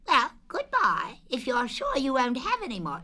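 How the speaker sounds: high, lively Rabbit voice; high fussy rabbit; Rabbit's voice. Acted. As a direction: pitch Rabbit up, brisk and fussy. Rabbit voiced in read story.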